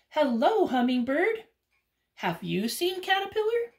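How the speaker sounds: high, eager raccoon. Acted. Raccoon asking hummingbird about Caterpillar, high voice. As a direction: call up brightly to the hummingbird.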